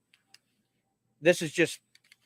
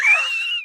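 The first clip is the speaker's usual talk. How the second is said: shrieking laugh; 5 dB louder than usual. Real, not acted. Bursts out laughing.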